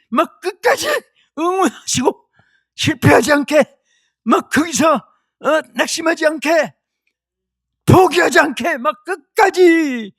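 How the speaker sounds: high, urgent preacher's rally; impassioned rising exhortation; fervent cheering on. Acted. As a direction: urge them on, pitched high. Acts out Jesus cheering Stephen on.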